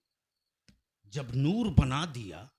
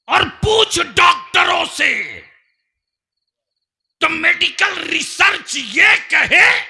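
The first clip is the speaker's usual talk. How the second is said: high, loud oratorical shout; full-force preaching; 12 semitones higher, 18 dB louder than usual. Real, not acted. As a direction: challenge the crowd at the top of your voice.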